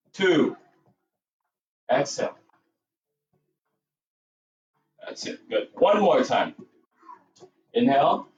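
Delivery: calm counted breathing cues; yoga breath cueing. Acted. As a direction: guide the class through each breath and count.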